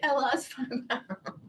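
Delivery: soft laugh inside I lost five pounds. Real, not acted. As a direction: laugh through the words, quietly. Same words, laughing.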